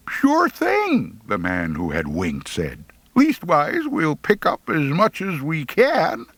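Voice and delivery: high cackling logger voice; mock the boys with a hidden joke; sly mocking logger. The winking logger promises to pick the boys up, sly and mocking.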